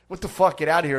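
indignant shout in a retold row; acted outrage. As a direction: act out an angry neighbour telling them off. Acted angry quote at noisy neighbour.

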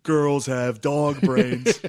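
loud, slow, drawn-out declaration; mock onstage rant. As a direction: stretch every word out loud and high, imitating a stage rant. Re-enacting his onstage rant at women.

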